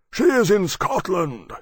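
high, loud, slow creature voice; dragon Maximus. Acted. Maximus giving Temeraire news of Lily, booming. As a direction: announce it in a big drawn-out voice.

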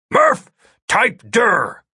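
high, slow, carrying shout. A narrator's reading. Agent shouting an order to his distant partner, shouting. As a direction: call it out word by word. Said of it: "he shouted".

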